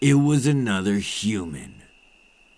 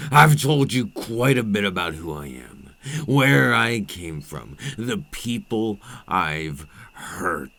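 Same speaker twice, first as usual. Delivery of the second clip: usual speaking voice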